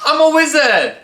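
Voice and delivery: high, loud mock proclamation; excited wizard cry; playful wizard shout. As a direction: yell it out as a pretend wizard. Playing at being a wizard.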